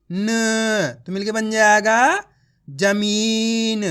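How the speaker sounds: long held syllables, then slow blended words like ककड़ी and जमीन; drawn-out reading drill; letter-by-letter demo. Shown as a demonstration. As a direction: sound out ली, गा, ल, then stretch लीगल. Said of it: "एक अक्षर का उच्चारण कर रहा हूँ".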